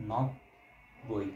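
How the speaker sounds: নব-বই split with a pause after নব; syllable-split demo. Shown as a demonstration. Teacher splits নব-বই for class, slow and separated. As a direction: say নব, stop, then বই, very slow. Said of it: "দুইটা ব একসাথে আছে".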